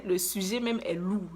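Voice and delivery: normal talking voice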